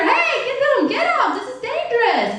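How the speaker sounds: high-pitched acted warning; hollering in alarm. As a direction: holler urgently at the trainer. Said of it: "we start hollering at the trainer".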